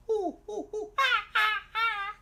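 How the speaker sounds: playful monkey ooh ah; monkey hoots. Pretend. Monkey noises in story.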